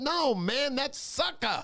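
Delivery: hammed-up friend setting him straight; voicing the artist. Artist's correction voiced in story.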